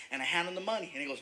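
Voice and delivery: normal talking voice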